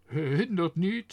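pained smile. A narrator's reading. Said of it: "beetje pijnlijk glimlachend".

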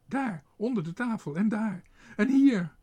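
van hoge roep naar luide gil; opbouwend geschreeuw. A narrator's reading. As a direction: steeds paniekeriger wijzen en roepen. Panicking lady points out mice. (dame wijst gillend de muizen aan, steeds harder)

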